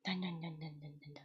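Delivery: whispered no, no, no in pretend fear; mock scared whisper. Streamer whispering to chat in mock fear. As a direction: breathe it like you're spooked. Fearing she might leave forever.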